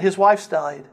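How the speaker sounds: ordinary talk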